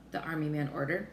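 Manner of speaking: usual speaking voice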